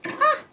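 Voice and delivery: sudden high-pitched yelp of surprise; a quick yelp at the tangled mess; short surprised squeak; startled high ah. Real, not acted. Yarn mess, finds the doll.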